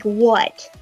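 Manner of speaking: normal talking voice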